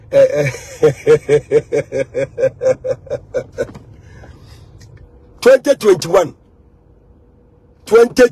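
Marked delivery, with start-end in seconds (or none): 0.8-3.6 long, low run of laughter; rolling low laugh. Real, not acted. Amused during own live video.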